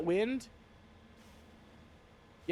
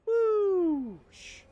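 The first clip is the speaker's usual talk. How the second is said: high drawn-out whooshing noise; whoosh sound; 5 dB louder than usual. Pretend. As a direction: make a flying whoosh. Flying off to a planet.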